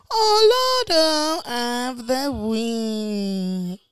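slow, drawn-out segment name; dramatic slow reveal of the segment; announcer title. Acted. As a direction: stretch it like a show host.

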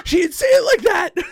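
very high, loud yelp; mock outraged protest. Acting out accused man objecting in court, mock shriek. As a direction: shriek it in comic disbelief.

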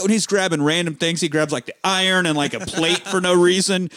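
ordinary delivery

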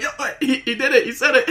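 very high, loud squeal through laughter; excited delight. Acted. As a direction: crack up as you announce the bit has happened. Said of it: "He's like".

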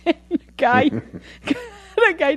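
laughing too hard to finish the sentence; words squeezed out between high laughs. Real, not acted. Telling a friend about the creepy guy, laughing high.

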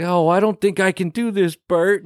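high, whiny scared plea; scared whine. Retold or imitated. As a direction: plead with the boss in a high whine. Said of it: "He's like".

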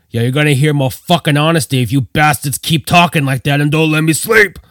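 a lightly voiced drunk yell at them to shut up. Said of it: "Coca yelled".

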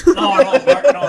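laughing out the correction, high and loud; breaking up mid-word while correcting himself. Real, not acted. Friend laughing through his correction of barking cats.

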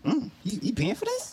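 high, doubtful mock clerk voice; suspicious store clerk. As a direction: raise the pitch, sound skeptical of the buyer. Staff doubting he will pay.

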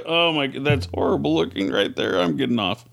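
ordinary talk

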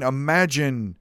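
usual speaking voice

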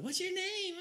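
put-on very high girly squeal. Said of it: "She was like".

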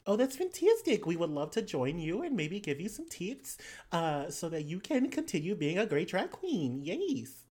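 put-on animated Australian-accented gushing; player's character, gushing. Character accepting drag queen's invitation, gushing. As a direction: gush with swooping Australian vowels.

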